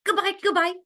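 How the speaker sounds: high, quick double goodbye; busy bees. Acted. Two bees leaving as rain falls, high and busy. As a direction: buzz out hurried high goodbyes. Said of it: "said the two bees very busily".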